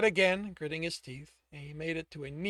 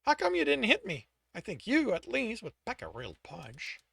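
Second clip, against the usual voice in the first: mocking; 7 semitones higher, 5 dB louder than usual. Alex mocking the big thugs.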